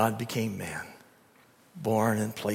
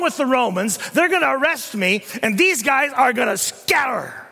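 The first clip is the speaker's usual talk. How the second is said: high, loud, pleading anguish; Jesus in Gethsemane crying to the Father in anguish; anguished Jesus praying; 13 semitones higher, 9 dB louder than usual. Acted. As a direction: pour out distress to the Father. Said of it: "Can you imagine?".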